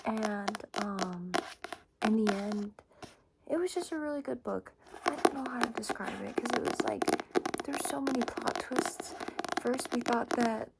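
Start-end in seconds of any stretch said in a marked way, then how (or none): none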